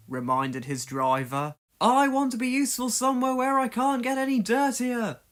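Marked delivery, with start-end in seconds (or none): 1.8-5.2 high, sulky huff; stubborn little engine. A narrator's reading. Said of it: "Percy huffed".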